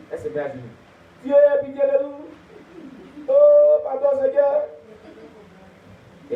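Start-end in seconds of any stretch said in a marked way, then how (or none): 1.2-4.6 oh crié très fort et haut; supplication. Acted. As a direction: implore à genoux. Woman pleading on knees. (femme à genoux qui implore Dieu, voix haute)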